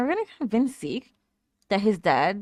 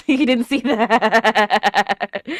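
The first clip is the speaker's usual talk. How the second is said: laughing; 2 semitones higher, 6 dB louder, much slower than usual. Real, not acted. Laughing at the character.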